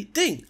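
bright spoken ding; idea ding. Pretend. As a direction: chime it like a lightbulb idea. Idea sound effect, voiced.

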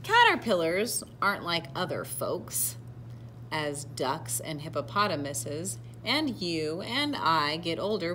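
animated read-aloud with deliberate emphasis; picture-book narration. A narrator's reading. Reader telling children of a proud caterpillar, storybook narration. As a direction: read to children, projecting key words.

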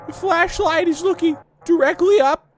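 loud, squeaky cartoon kid voice; Carl Wheezer. Gamer as Carl looking round the cave, high cartoon impression. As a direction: exclaim in a very high put-on voice. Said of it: "I'm gonna go to Carl Weezer impressions".

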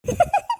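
a sudden squeaky giggle; high burst of laughter. Real, not acted. Daughter laughs at her father's remark about grandpa's big moustache, high giggle. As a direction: let out a high little laugh. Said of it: "எதுக்குமா சிரிக்கிற".

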